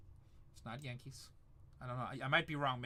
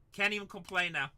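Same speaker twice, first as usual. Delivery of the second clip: ordinary talk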